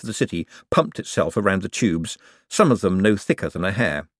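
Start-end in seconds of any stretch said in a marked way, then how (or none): none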